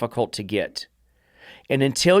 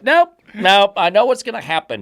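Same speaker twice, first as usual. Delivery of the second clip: voicing the dog; 6 semitones higher, 5 dB louder than usual. Dog voiced avoiding the stick.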